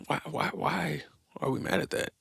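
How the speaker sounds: ordinary delivery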